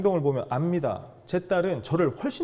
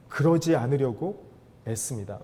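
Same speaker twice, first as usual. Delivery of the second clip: usual speaking voice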